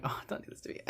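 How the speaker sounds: whispered, breathless plea to the movie; dread whisper. Retold or imitated. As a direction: whisper it like you fear the twist. Her own dread reaction retold.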